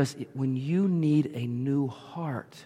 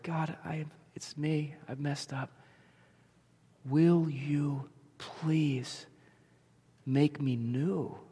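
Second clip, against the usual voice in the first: soft, slow and humble; tender prayer; 3 dB quieter, slower than usual. Acted. Preacher modelling a humble prayer to God, soft and slow. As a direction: quietly confess and ask.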